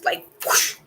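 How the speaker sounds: soft breathy whoosh of wind. Retold or imitated. Telling viewers about the tornadoes, whispered whoosh. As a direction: blow the word out like a gust. Describing tornadoes hitting the city.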